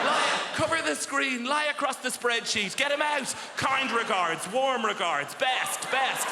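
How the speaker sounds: high, hammed-up frantic orders; panicked office workers. Office workers hiding screens from a visitor, high mock panic. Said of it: "That's how they talk".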